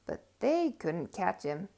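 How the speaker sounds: ordinary delivery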